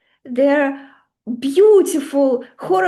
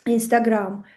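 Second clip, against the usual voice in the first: ordinary talk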